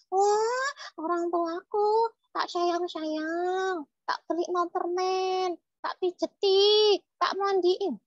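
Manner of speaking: put-on high childlike character voice; boastful puppet child. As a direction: list it proudly as a little kid. (suara boneka anak membanggakan diri)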